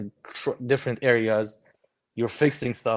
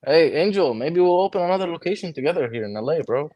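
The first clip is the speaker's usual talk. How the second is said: raised, higher voice hailing a friend across the room; calling out; 6 semitones higher, 4 dB louder than usual. Real, not acted. Friend calling across the room to Angel, raised and excited. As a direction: call out loud and bright to Angel.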